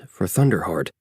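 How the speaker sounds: ordinary delivery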